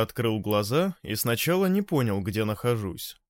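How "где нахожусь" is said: ordinary delivery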